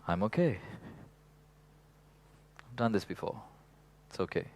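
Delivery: soft calming voice to yourself; reassuring. Retold or imitated. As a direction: soothe yourself gently. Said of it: "Or you can go".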